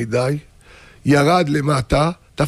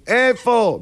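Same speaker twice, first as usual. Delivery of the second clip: high, insistent holler; calling out; 8 semitones higher, much slower than usual. Acted. Husband unable to find formula, hollering to wife. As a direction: call it out high, exasperated. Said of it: "עכשיו צעקה שתיים".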